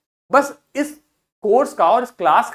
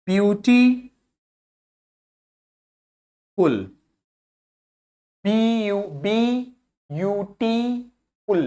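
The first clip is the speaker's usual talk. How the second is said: "Beautiful" broken into drawn-out syllables; syllable demonstration; 3 dB quieter, much slower than usual. Shown as a demonstration. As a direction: stretch "beautiful" slowly as ब्यू–टी–फुल, pausing between beats. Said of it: "चिन जितनी बार नीचे आएगी उतने syllable".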